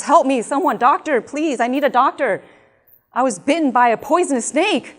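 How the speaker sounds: loud, high plea for a doctor; screaming for help. Acted. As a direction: act out a panicked snakebite victim calling out. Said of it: "and he screams".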